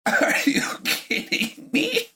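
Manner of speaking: very high, whispered squeak; high breathless squeal; squeaky disbelief. Real, not acted. Reacting again to the trade.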